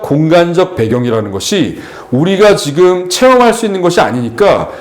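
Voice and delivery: ordinary talk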